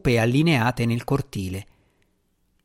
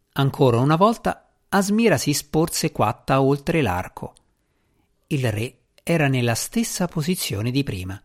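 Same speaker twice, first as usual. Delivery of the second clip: usual speaking voice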